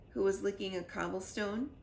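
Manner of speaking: ordinary delivery, nothing marked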